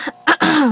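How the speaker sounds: clears throat mid-sentence; a quick clearing of the throat. Real, not acted. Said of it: "excuse me".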